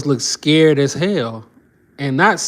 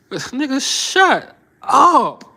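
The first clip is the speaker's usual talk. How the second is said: laughing dismissal; 9 semitones higher, much slower than usual. Real, not acted. Mocking the lawyer's threat.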